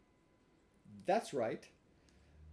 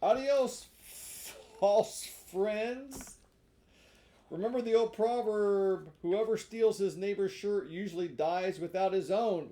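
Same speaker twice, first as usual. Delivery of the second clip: Pinocchio's farewell; 6 semitones higher, 7 dB louder, slower than usual. Acted.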